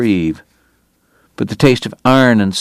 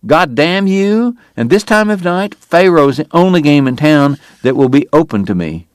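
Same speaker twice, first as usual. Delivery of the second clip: high, angry outburst; a proud sick man lashing out at a friend; cursing; 7 semitones higher than usual. Acted. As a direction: spit the curse at him. Doc curses Morgan in anger.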